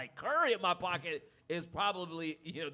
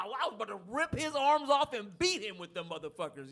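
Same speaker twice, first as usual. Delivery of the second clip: usual speaking voice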